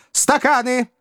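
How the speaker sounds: high-pitched volley of short orders; yelled orders. A narrator's reading. Stranger ordering grog from the waiter, high yelled orders. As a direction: call out the order item by item, high. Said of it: "заорал незнакомец".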